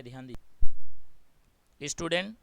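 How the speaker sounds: sudden booming call to the class, slowed right down; loud call for attention. Real, not acted. Teacher loudly calls on her students to pay attention, booming. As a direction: bark it out to wake the students up.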